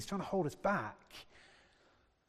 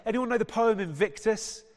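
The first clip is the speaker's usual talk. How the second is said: ordinary talk, nothing marked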